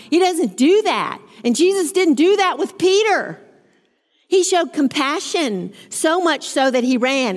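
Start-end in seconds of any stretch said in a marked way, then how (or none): none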